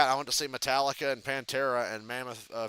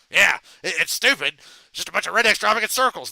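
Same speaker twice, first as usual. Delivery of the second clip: louder, higher hyper Beavis; Beavis impression; 5 semitones higher, 10 dB louder than usual. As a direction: squawk it out like excited Beavis. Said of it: "Beavis and Butthead".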